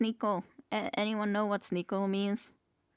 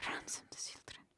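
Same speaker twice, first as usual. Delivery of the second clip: barely voiced whisper, far softer; whispered aside; 15 dB quieter than usual. Real, not acted. Player muttering a menu option to themselves, whispered. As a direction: read it under the breath.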